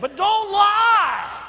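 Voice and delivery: very loud, high, slow drawn-out cry; shouted command. Acted. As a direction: bellow it at the church. Preacher's climactic warning.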